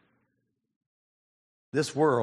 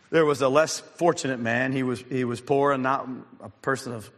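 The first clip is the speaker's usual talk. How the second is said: usual speaking voice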